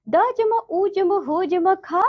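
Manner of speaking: ordinary delivery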